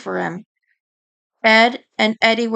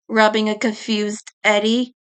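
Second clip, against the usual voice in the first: plain reading voice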